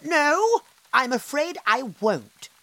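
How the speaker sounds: firm fairy. Acted. The disguised fairy refusing the tree, firm. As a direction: refuse calmly but firmly. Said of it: "said the old woman".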